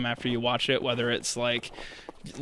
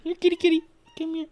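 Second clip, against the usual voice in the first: calling a cat; 5 dB louder than usual. Real, not acted.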